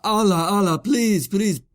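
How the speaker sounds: high-pitched imitation of frantic begging; mock screaming plea. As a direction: act out a desperate man screaming for money. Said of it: "just screaming about Allah".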